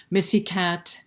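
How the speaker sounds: usual speaking voice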